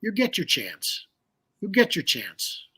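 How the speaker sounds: soft, slow quote of a teacher; hushed, measured imitation of a patient teacher; imitated reassurance. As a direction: reassure quietly and unhurriedly, as the mentor did. Imitates teacher calming his worry.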